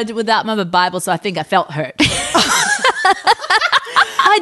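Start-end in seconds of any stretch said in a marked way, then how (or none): 2.0-4.2 high squealing laugh; burst of laughter. Real, not acted. Mother laughing at her own Bible joke, high and loud.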